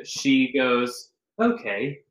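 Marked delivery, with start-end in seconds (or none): 1.4-2.0 Siri voice. Retold or imitated. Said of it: "a little Australian, like, accent on our phones".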